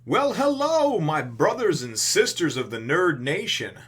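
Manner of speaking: sing-song high showman hello; showman greeting. Acted. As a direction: greet the crowd like a host. Greeting to the Nerd Nation.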